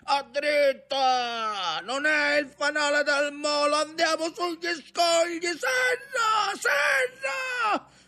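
very high cries, climbing in pitch; frantic shouted orders. A narrator's reading. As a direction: yell warnings with rising panic. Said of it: "gridò padron Ntoni". (ordini gridati, sempre più acuti)

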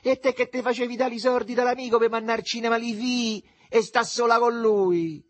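loud, very high-pitched tirade; mother yelling. A narrator's reading. Mother answers the neighbour's insults, shouting. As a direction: fire back at the top of your voice. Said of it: "gridò mettendosi una mano sul fianco".

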